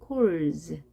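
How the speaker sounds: "Calls" with a vibrating z at the end; voiced z ending. Shown as a demonstration. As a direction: let the end of "Calls" buzz as z. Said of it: "떨림이 있으니까요". ("Calls" 끝을 떨리는 즈로)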